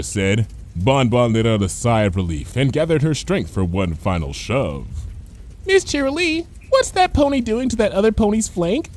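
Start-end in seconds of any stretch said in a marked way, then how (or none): 5.7-8.9 lightly voiced little filly asking; small child's voice. A schoolfilly asking her teacher about the ponies. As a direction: ask innocently like a small child. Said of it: "came a small voice".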